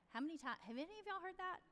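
ordinary delivery

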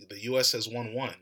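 ordinary talk

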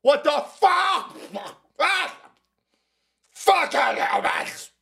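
high, slow yelling Bigfoot voice. Acted. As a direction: howl it out like a furious Bigfoot. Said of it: "Bigfoot is even coming out of the hills going".

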